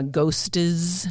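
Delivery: ghostes said as GHOST-es, an added es syllable; ghostes with extra syllable. Shown as a demonstration. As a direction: stretch ghostes into two beats, GHOST-es. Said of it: "Adding the is sound to the end of plural words".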